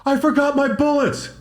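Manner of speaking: high, slow mock whine of a guilty hunter; sheepish confession. Quoting the backup hunter who forgot his bullets, high mock whine. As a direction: whine it like a kid caught out. Mocking the chubby backup guy.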